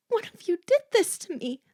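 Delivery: high, tense, accusing voice; trembling accusation. A narrator's reading. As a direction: accuse them, on the edge of tears. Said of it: "in a low, trembling voice".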